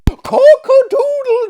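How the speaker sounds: high, loud crowing cry; rooster crow. A narrator's reading. Madwoman by the fireplace crows like a rooster. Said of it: "sing out incessantly at the top of her voice".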